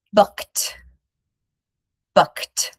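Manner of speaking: booked ending in a clipped T; booked as one syllable. Shown as a demonstration. Teacher modelling booked with no extra syllable. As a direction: say booked as bookt. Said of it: "Same will happen for book".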